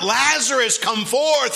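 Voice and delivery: high, loud preacher's shout; yelled command. Acted. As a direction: call it out as a mighty command to the dead. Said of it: "he yelled out".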